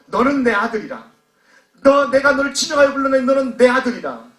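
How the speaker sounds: high, loud, forceful declaration; emphatic proclamation. Acted. The Lord claiming the outcast as son, loud proclamation. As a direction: proclaim it with full conviction.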